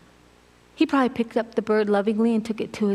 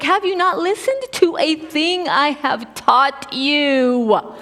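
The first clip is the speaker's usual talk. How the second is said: higher, slow, exasperated scolding; slow, raised voice of an exasperated teacher; angry rebuke; 9 semitones higher, 3 dB louder, slower than usual. Retold or imitated. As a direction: scold the disciples as if they should know better. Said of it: "gets angry with the disciples".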